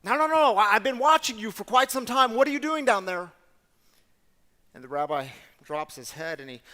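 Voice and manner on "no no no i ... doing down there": insistent shout. Retold or imitated. Officer confronts hiding rabbi.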